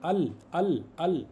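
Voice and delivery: अल repeated with a distinct final L; lam pronounced. Shown as a demonstration. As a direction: land clearly on the L of अल. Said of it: "लाम pronounce हो रहा है ना". (अल में लाम पर ज़ोर)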